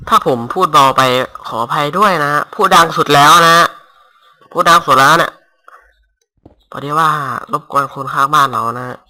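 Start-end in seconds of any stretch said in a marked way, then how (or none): 1.5-5.3 louder, pushing the voice to its limit; loudest voice. Real, not acted. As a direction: belt it out so the mic catches every word.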